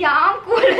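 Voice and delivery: mock-cool, laughing through it; laughing brush-off. Girl reassuring fussing mothers, laughing brush-off.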